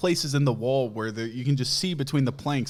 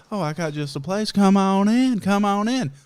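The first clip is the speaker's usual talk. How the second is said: Southern hostess; 8 semitones higher, 4 dB louder than usual. Retold or imitated. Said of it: "my mom was like".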